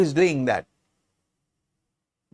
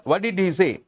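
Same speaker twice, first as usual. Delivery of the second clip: ordinary talk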